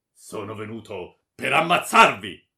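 very loud, grim threat; dark menace. A narrator's reading. Baron answers that he has come to kill her, grimly. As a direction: thunder it darkly, meaning to kill. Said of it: "rispose il barone cupamente".

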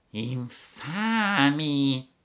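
very slow, drawn-out fox voice; fox's mock outrage. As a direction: drag out the word in fake disgust. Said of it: "disse la volpe". (la volpe finge sdegno, lentissima)

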